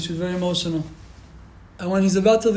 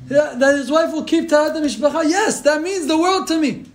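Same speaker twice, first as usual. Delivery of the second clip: high-pitched, fervent sermon climax; impassioned preaching; 7 semitones higher, 4 dB louder than usual. Real, not acted. Speaker preaching the rabbi's priorities to listeners, impassioned. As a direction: drive the moral home with rising fervor. Preaches the moral at full force.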